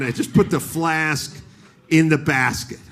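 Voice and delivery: ordinary delivery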